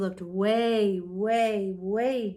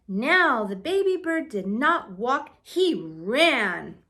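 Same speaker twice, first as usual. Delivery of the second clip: usual speaking voice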